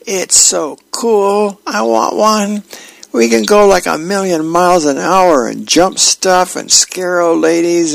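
put-on high-pitched little boy bursting with news; squeaky, thrilled child voice; excited kid. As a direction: gush breathlessly like a kid begging mom. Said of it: "rushing in to the room and yells".

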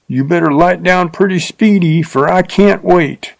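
a coarse rider hurrying her along; rough man, amused. A narrator's reading. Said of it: "A loud guffaw".

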